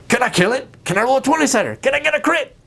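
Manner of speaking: a bloodthirsty player begging to attack; eager murder hobo. Retold or imitated. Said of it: "the murder hobo at your gaming table".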